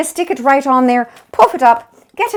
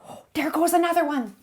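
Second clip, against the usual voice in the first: hushed whisper of a pretend sighting; 5 semitones higher, 7 dB quieter than usual. Cook spotting another leprechaun, whispering softly. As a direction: whisper it so the leprechaun won't hear.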